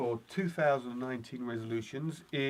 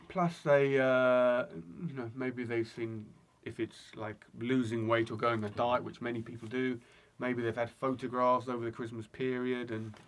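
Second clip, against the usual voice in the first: ordinary talk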